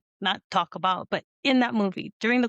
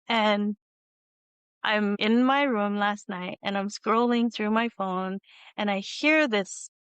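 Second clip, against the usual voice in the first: ordinary talk, nothing marked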